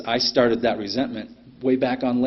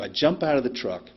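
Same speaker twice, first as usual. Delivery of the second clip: ordinary talk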